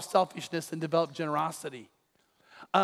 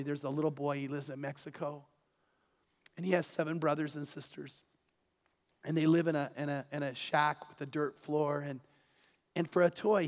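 soft, hushed storytelling; bedtime story voice; 3 semitones lower, 6 dB quieter than usual. Real, not acted. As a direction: tell it low and tender. Father telling bedtime story.

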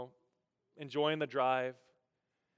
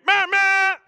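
loud, high honking blasts; imitated car horn; 14 dB louder than usual. Another driver warning of a near sideswipe, imitated honking. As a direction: honk like an angry car horn. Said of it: "and then I hear".

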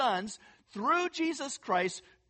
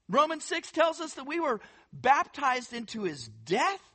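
normal talking voice

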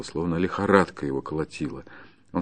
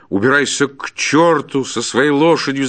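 loud, high shouted dismissal; angry shout; 6 semitones higher, 6 dB louder than usual. Acted. Forester throws the peasant out, shouting angrily. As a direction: shout after him to clear off. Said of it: "закричал ему вслед".

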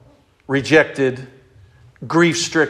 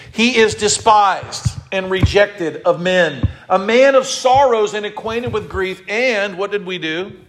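slow, high, ringing sermon reading over music; preaching scripture; 7 semitones higher, 3 dB louder, slower than usual. Acted. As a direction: proclaim it slowly like a pulpit reading.

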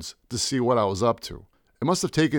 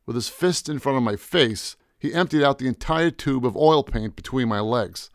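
ordinary delivery

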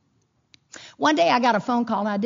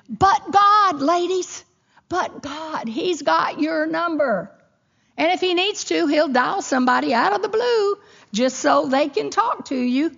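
preaching at full force; 6 semitones higher, 3 dB louder than usual. Real, not acted.

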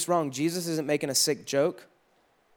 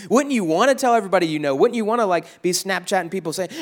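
ordinary talk, nothing marked

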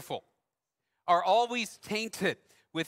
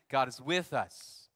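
reassuring repeat; 5 semitones lower, much slower than usual. Retold or imitated. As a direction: hush a frightened child gently. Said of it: "she'd say it again".

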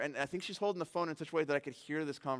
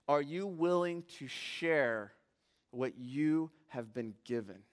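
slow weighty question; slower than usual. Real, not acted. Climactic question to congregation.

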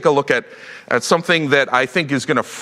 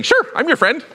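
breezy shrug; 12 semitones higher than usual. Retold or imitated. Shallow Peter answer.

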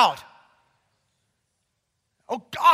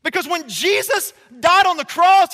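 usual speaking voice

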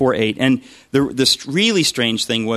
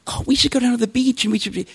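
much higher, bright mock-perky voice; chipper woman; 12 semitones higher, faster than usual. Housemate at dawn urging a beach trip, mock-chipper and much higher. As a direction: imitate an overly chipper early riser. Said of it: "she was all chipper".

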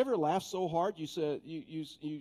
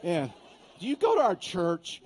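high, louder startled squeak of disbelief; mock startled; 5 semitones higher, 7 dB louder than usual. Pastor retelling his shocked reply to the trash lady. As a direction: act like the question caught you off guard.

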